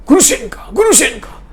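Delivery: high, urgent call of her name; startled man calling his beloved from the next room; frantic calling. Acted. Dmitri jumps up, calling her.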